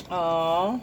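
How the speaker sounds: ordinary delivery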